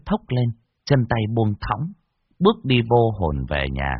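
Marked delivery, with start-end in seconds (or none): none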